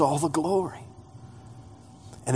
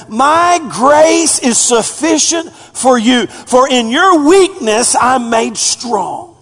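slow proclamation; 7 semitones higher, 4 dB louder, slower than usual. Acted. God's words quoted, preached slowly.

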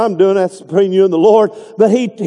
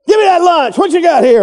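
much higher, pushy grab; mock demand; 8 semitones higher, 3 dB louder than usual. Disciple demanding a kid's lunch, hammed-up pushy. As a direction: bark it like snatching lunch.

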